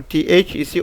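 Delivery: ordinary talk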